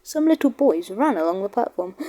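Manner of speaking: ordinary delivery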